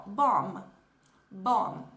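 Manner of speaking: "Bomb" com M final e lábios fechados; "Bomb" concluído, M bem marcado; English bomb. Shown as a demonstration. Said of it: "pronunciaria ela mais ou menos dessa forma". ("Bomb" em inglês, fechando a boca)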